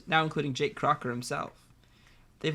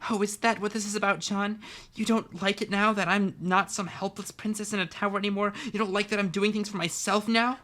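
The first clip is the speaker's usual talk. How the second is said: higher, louder bitter outburst; angry; 7 semitones higher, 4 dB louder than usual. Acted. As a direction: snap back with bitter fury. Jade furious at John.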